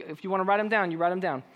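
ordinary talk

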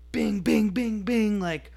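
zooming dog sound effect. Retold or imitated. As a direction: punch out each bing like a dog ricocheting about. Imitating dog darting around.

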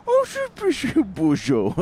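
mock Adam Sandler squeal. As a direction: blurt it like a silly comedy character. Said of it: "Is that Adam Sandler?".

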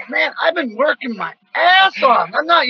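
high, slow mock outraged shout; hammed-up protest, half shouting; loud, high whiny defense of his diet; retold indignant yell. Retold protest about working hard.

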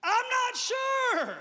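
slow mock shout; sheepish shout. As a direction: call out the doubt slowly at full volume. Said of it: "This guy over here said".

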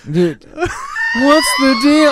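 high, drawn-out stand-up whine; Jerry Seinfeld. Retold or imitated. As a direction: stretch out the question, comic. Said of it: "I can do Jerry Seinfeld".

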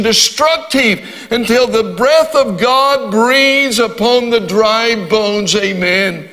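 rousing preacher's delivery, drawing out key words; fervent preaching. Real, not acted. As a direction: preach it with rising fervour, stretching the big words. Preaches at full flow.

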